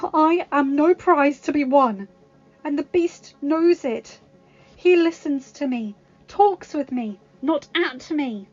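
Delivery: high, loud and emphatic, slowing on key lines; impassioned rejection. Acted. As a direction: tell him off, then declare love. Belle rejects Gaston.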